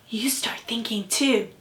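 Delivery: a quiet whispered nudge to the listener. Real, not acted. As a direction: hush it like sharing a secret. Storyteller hushes child listener.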